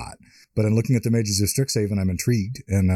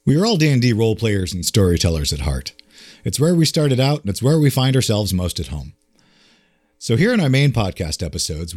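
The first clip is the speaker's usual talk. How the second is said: loud, punchy intro read; announcer read; 3 semitones higher, 5 dB louder than usual. Acted. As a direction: sell the show with energy. Podcast intro read over music.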